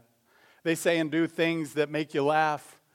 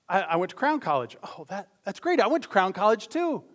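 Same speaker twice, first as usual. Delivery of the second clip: eager fellow student; 5 semitones higher, 4 dB louder than usual. Retold or imitated. Voicing both men in joke.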